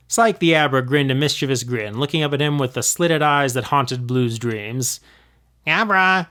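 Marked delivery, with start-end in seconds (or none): 5.7-6.3 higher, sly call of its own name; a cheeky Pokemon grinning; smirking creature. Acted. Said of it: "he smirked".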